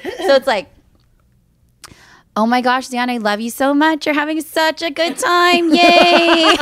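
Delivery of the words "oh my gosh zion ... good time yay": bright, gushing praise that climbs to a loud yay; fake happy with straight face. Shown as a demonstration. Showing friends how to sound happy with a straight face, gushing. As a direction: sound thrilled while keeping a blank face. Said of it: "keeping a straight face, but sounding like you're really happy".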